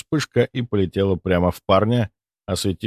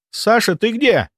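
high anxious call; worried call; 13 semitones higher, 3 dB louder than usual. Acted. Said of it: "встревоженный голос Сени".

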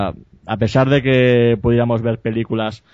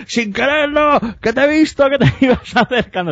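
much higher, put-on accusing voice with a laugh at the end; imitated big arcade guy; 13 semitones higher than usual. Big arcade guy calling out the boy who pressed his button, high mock accusation. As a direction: call him out, high and mocking.

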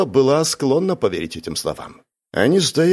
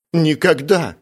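high-pitched indignant exclamation; righteous indignation; 7 semitones higher, 3 dB louder, slower than usual. A narrator's reading. Miss Spencer defending her husband against murder, indignant. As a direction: deny it as if shocked by the thought. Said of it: "воскликнула мисс Спенсер с праведным возмущением".

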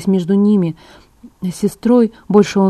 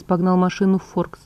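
ordinary delivery, nothing marked

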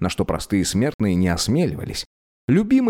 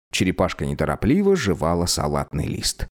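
ordinary delivery, nothing marked

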